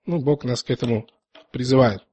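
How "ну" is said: ordinary talk, nothing marked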